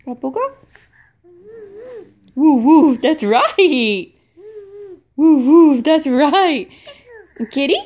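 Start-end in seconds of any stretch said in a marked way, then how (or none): 2.4-3.0 loud playful dog bark; loud playful woof. Acted. Dog sound for toddler. 5.2-5.8 loud playful dog bark; loud playful woof. Acted. Dog sound again.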